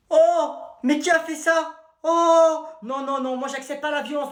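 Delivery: high-pitched, indignant cry; hammed-up shock at the class; shocked teacher. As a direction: exclaim in high alarm. Teacher discovers violence in class.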